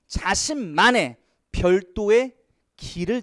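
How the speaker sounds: drawn out, slow, each word leaned on; teacher stretching 자신만의 out word by word; slow emphatic reading. Shown as a demonstration. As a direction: read it slowly so students catch it. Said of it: "이런 거 잘 봐야 돼요".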